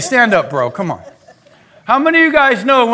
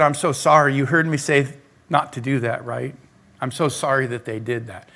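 soft apology; 7 semitones lower, 6 dB quieter than usual. Retold or imitated. Consoling a crying church member.

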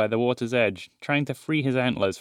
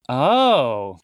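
loud sudden realization; surprised; 6 dB louder than usual. Acted. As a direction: gasp of sudden understanding. Sister suddenly understands.

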